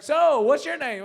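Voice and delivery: mock overeager, loud and high; slow, booming conversation opener, imitated; loud chatty stranger. Seatmate interrupts his reading to chat, loudly imitated eagerness.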